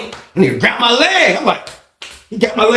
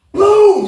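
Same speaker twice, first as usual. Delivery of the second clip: throw boom; 3 dB louder than usual. Retold or imitated. Sound of the throw.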